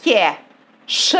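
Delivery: "Que é" merged into one beat, then "ch" alone; syllable by syllable. Shown as a demonstration. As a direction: separate beats for "Que é" and "ch", pausing between. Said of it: "Então vamos dividir". (escansão pausada de "Que é ch")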